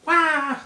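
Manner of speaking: high loud yelp while falling; falling scream. Pretend. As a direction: wail as you drop. Villain thrown into jail.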